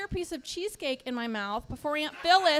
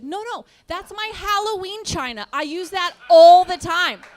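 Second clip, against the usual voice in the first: protesting wife, higher, building louder; higher-pitched pleading protest; insistent wife; 4 semitones higher, 14 dB louder than usual. Acted. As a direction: insist indignantly, punching it louder at the end. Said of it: "his wife said we needed it".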